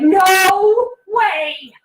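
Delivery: put-on a child wailing in the store over the stickers; kid's excited outburst. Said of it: "he's like".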